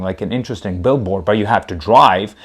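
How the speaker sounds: usual speaking voice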